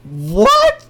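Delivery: high loud disbelieving what; shocked what. Real, not acted. Co-host hearing the news, shocked disbelief. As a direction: blurt it out in disbelief.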